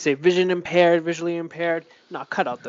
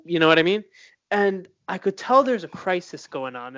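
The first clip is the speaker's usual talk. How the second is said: ordinary talk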